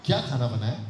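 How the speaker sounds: low, casual question; first way of asking. Shown as a demonstration. Teacher repeating the three tones, low and casual. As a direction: ask it low and neutrally. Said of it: "ये तो वैसे ही हो गया".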